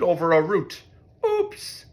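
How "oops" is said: very high squeaked oops; a tiny startled squeak. A narrator's reading. Mole trips and squeaks a very high oops. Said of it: "he squeaked".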